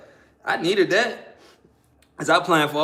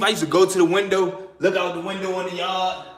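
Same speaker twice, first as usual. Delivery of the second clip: normal talking voice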